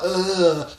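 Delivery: high, loud mock groan of an overheated man; a hammed-up heat-stroke groan; imitating a groan. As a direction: groan like you're melting in the heat. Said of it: "and he was like".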